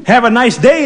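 cheery, lilting chirp; chirpy. Acted. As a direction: chirp it out to the customers. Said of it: "the clerk chirped".

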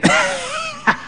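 put-on squealing old-lady laugh; high cackle. Old woman cackling in the car, very high.

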